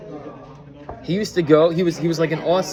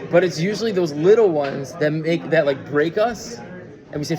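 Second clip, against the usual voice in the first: ordinary delivery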